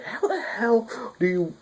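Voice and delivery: high, slow, mock incredulous squeak. Bunny girl teasing Kumagawa, high mock incredulous voice. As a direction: squeak it out high, mocking disbelief. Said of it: "they're like".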